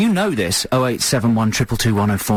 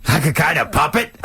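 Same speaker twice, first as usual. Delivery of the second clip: lightly voiced puppet talk, higher and drawn out; joking that Zach Braff talks like a puppet, light puppet voice; mock puppet voice; 3 semitones higher than usual. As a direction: tease the caller by lightly doing a puppet. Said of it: "And he talks like this".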